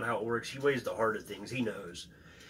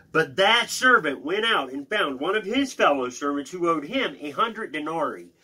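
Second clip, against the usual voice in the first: higher, emphatic Bible reading; scripture reading; 4 semitones higher, 5 dB louder than usual. Acted. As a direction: read the parable out, punching key words. Reads scripture aloud.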